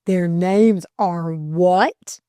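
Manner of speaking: "what" drawn out and swooping up; slow disbelief, rising sharply on "what"; incredulous question. A narrator's reading. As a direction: stretch "what" into an astonished question. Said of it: "the special intonation of the question".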